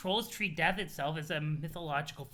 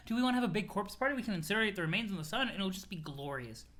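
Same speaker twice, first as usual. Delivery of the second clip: ordinary talk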